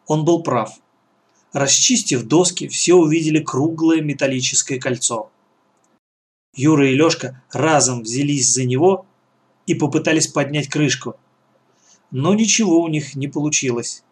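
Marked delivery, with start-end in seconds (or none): none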